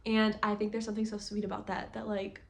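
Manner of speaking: ordinary delivery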